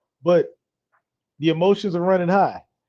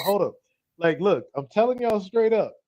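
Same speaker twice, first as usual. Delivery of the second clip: ordinary delivery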